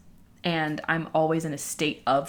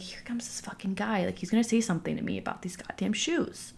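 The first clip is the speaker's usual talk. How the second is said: whispered aside under breath; 3 semitones higher, 5 dB quieter than usual. Retold or imitated. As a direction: whisper it to yourself. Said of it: "I was like".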